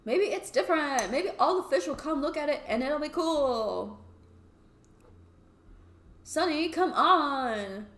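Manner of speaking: high, loud, whiny pressure; wheedling Toby. Acted. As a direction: badger a friend with a drawn-out come on. Voices Toby wheedling Sunny.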